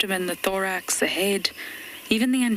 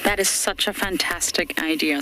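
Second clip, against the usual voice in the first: ordinary talk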